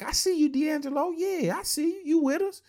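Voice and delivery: high, excited cheering bystanders; mock fans egging him on; hyped teammates. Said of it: "people be like".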